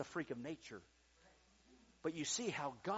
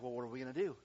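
ordinary talk, nothing marked

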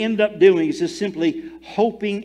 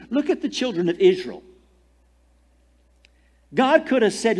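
ordinary delivery

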